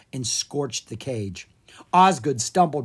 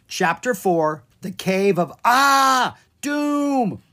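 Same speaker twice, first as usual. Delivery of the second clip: dramatic announcer; 9 semitones higher, 6 dB louder, slower than usual. A narrator's reading. Dramatic chapter title.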